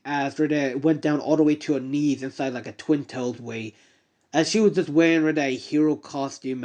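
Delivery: usual speaking voice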